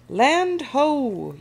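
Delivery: high, loud, drawn-out call; lookout's yell. A narrator's reading. First mate spotting the island, yelling. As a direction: sing out the sighting like a lookout. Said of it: "yells First Mate Callie".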